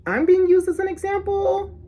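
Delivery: high, loud disbelief; offended high-pitched query; incredulous question. Retold or imitated. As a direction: pitch it way up, as if you can't believe it. Incredulous at being the example.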